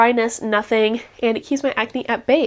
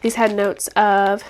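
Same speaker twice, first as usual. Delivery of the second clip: normal talking voice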